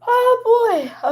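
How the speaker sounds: high-pitched delighted cry; high excited exclamation. Real, not acted. Reacting to machine finishing.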